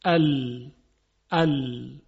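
a clipped "ال" with the tongue tip on the upper gums; isolated lam sound. Shown as a demonstration. Quran teacher demonstrating "ال" to listeners, crisply. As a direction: sound out "ال" twice as a clean L.

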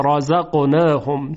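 رزقناهم slowly, ra thick and open; heavy ra. Shown as a demonstration. (رزقناهم آهسته با رای درشت)

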